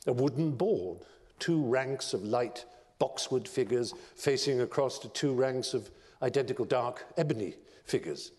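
plain reading voice

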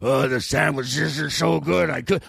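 mock monster. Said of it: "what a monster should say".

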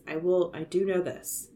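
usual speaking voice

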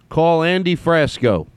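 slow, high, deliberate phone command. Real, not acted. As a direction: spell it out slowly for the phone to catch.

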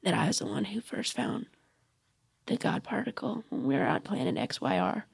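low, very soft warning; whispered threat. Acted. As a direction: warn your rival under your breath.

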